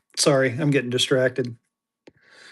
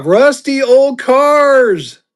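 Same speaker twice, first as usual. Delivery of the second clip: much higher, louder, very slow; goofy stretched-out voice; 10 semitones higher, 7 dB louder, much slower than usual. Pretend. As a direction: drag it out in a silly high voice. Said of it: "repeated drawn out".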